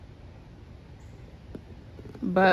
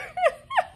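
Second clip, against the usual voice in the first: very high, wheezing laugh; helpless laughter. Real, not acted. Vlogger losing it over the missing camera, helpless laughter. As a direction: dissolve into a squeaky laughing fit. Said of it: "I don't know why I'm laughing".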